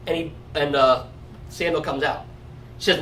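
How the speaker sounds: ordinary talk, nothing marked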